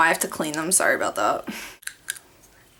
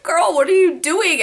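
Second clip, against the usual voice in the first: incredulous squeal; 10 semitones higher, 4 dB louder than usual. Retold or imitated. Mom burping uncontrollably.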